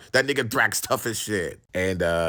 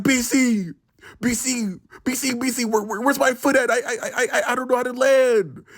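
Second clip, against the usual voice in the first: flail in a mock panic mid-climb; panicking friend; 9 semitones higher than usual. Stoned friend stuck on the wall, imitated high panic. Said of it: "he was panicking mid-climb".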